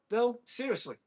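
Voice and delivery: ordinary talk, nothing marked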